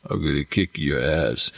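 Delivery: a hint of a low, menacing action hero; low Rambo menace. Imitating Rambo's menace to show why his silence scared audiences. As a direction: growl the threat out low like a silent tough guy.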